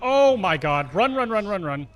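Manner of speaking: panicked shouting, fleeing; being chased. Real, not acted. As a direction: yell it in a panic while running.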